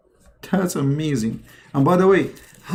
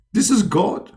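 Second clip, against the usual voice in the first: usual speaking voice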